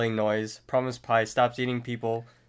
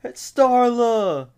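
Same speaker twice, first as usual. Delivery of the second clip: high, loud drawn-out holler; hammed-up anguished yell; imitated scream; 12 semitones higher, 9 dB louder, slower than usual. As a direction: scream the name out long, in character. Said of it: "screams out to Starla".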